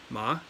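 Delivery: "Ma" sliding up in pitch; second-tone "Ma", pitch climbing; rising tone. Shown as a demonstration. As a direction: lift "Ma" like a question. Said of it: "It's a rising tone".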